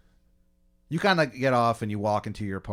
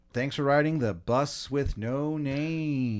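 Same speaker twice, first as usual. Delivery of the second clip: higher, slow, drawn-out farewell; bus driver's sign-off; 5 semitones higher, slower than usual. Acted. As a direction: announce the sign-off with showy slowness.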